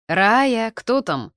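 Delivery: loud, stretched call of a name; calling from afar. A narrator's reading. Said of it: "услышала я голос Артура из глубины квартиры".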